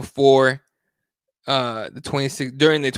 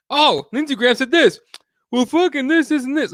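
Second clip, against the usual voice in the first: high, worked-up mock whine of blame-shifting; mocking deflection; 10 semitones higher than usual. Host mimicking people who copy politicians' blame game, mocking. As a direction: pitch it way up, imitating excuse-makers.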